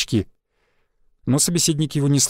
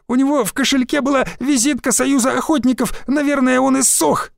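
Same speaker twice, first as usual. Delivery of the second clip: much higher, tearful rushed words; sobbing gabble; 9 semitones higher than usual. A narrator's reading. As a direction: sob it out in a hurry. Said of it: "всхлипывая, затараторил". (всхлипывая, тараторит, намного выше)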